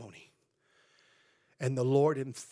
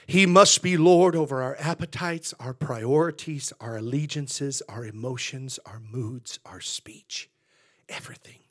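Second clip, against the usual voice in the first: starts loud, sinks down to a whisper; fading to a whisper; 2 semitones lower, 5 dB louder than usual. Real, not acted. Pastor to congregation on Lord over everything: loud fading to whisper. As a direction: preach it big, then let it drain away to a breath.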